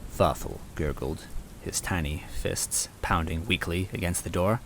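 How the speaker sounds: ordinary delivery, nothing marked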